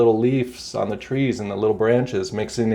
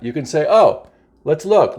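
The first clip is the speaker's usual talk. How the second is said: usual speaking voice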